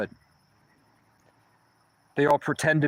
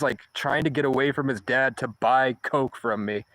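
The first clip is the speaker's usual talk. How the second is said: ordinary talk, nothing marked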